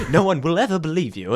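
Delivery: lightly voiced prim droid; quick fussy robot butler; C-3PO voice. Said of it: "as C three P O".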